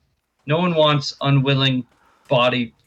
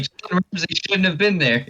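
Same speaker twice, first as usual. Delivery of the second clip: mock excuse; 6 semitones higher than usual. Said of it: "He's like".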